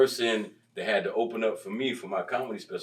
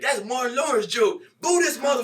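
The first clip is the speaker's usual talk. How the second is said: heckler accusation; 14 semitones higher than usual. Retold or imitated. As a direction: call him out from the crowd. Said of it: "He stood up and said".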